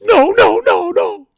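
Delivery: squeaky high, loud mock panic; high frantic imitation of a panicking friend; mock panicked no's. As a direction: yelp it like a cornered guy panicking.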